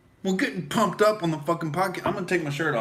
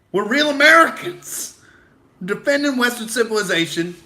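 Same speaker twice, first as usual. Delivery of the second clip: much higher, louder hammed-up battle cry; mock patriot shout; 8 semitones higher, 5 dB louder than usual. Host yelling his mock patriotic slogan, higher and louder. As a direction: belt it like a cartoon patriot.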